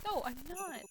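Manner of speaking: high, flustered denial; defensive denial. Acted. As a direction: deny it, panicked.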